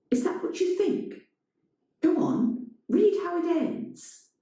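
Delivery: high, daring him; challenging. A narrator's reading. Elena challenging.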